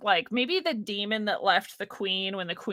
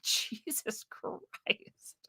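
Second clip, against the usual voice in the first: whispered and slow, worn out; exasperated whisper; 2 semitones higher, 8 dB quieter, much slower than usual. Retold or imitated. Answering a troll in a weary whisper. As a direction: breathe it out in disbelief. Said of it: "I'm like".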